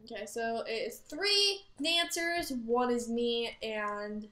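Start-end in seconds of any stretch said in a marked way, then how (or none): none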